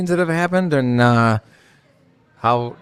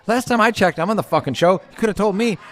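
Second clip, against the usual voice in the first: higher-pitched mock protest; retold objection, pitched up in indignation; indignant retort; 7 semitones higher than usual. Host retells objecting to being left out, indignant. Retelling a quarrel over meeting.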